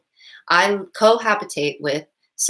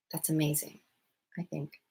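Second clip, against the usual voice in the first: soft aside; 11 dB quieter than usual. Real, not acted. Marvelling aside continues.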